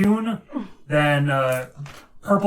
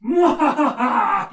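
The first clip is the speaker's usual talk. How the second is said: high slow evil cackle; villain cackle; 10 semitones higher, 5 dB louder, much slower than usual. Acted. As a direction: cackle like a gloating wizard. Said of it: "He is going to cackle out".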